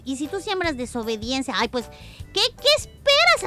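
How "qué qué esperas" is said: high, loud exaggerated question; surprised. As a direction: ask in mock amazement. Rhetorical question to kids.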